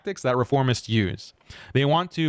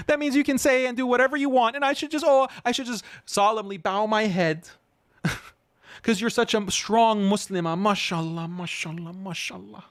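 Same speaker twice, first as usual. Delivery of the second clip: sarcastic mockery; 7 semitones higher than usual. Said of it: "just because you're a mom".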